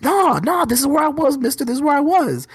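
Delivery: mock student protest, pitched high and held there; high-pitched, adamant retort, imitating a student; insistent student. Student argues with teacher over the map, mock-insistent.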